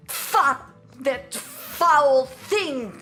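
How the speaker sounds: drawn-out, punched loathing; disgusted outburst. Acted. Villager reviling the dark magic disease, disgusted. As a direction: spit it out slowly with revulsion.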